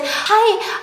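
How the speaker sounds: put-on lilting high greeting like a sweet old lady; sweet high hello. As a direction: chirp it up high. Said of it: "she's like".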